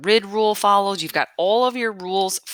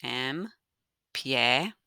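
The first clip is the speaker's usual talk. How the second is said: "Empiecen" very slow and softer, broken into three syllables; 4 semitones lower, 7 dB quieter, much slower than usual. Shown as a demonstration. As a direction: softly space out "Empiecen" as em, pie, cen with long gaps. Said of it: "divide my verb empiecen into three syllables".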